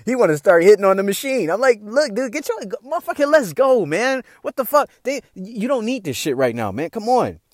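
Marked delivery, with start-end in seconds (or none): 1.8-7.4 high, urgent pleading; exasperated. Retold or imitated. To friend hitting the ATM, high exasperated urging. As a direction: drag him away, impatient.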